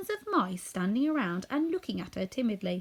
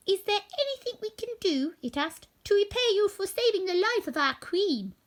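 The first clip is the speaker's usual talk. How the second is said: much higher, eager little voice; squeaky mouse; 11 semitones higher, 3 dB louder than usual. A narrator's reading. Biggest mouse asking the woodman, high squeak. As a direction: offer help earnestly.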